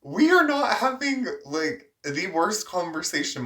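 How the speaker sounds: high, loud and disbelieving; telling friends how absurd the call got, incredulous. Real, not acted. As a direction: pitch it up in outrage. Indignant retelling of escalation.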